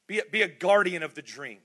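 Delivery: ordinary delivery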